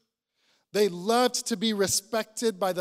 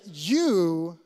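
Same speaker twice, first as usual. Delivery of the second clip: "You" punched loud and set apart; emphatic you; 2 semitones lower, 5 dB louder than usual. Retold or imitated. Jesus turning to his disciples, stressing "You". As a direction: make "You" land as a pointed rebuke. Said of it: "to kind of say".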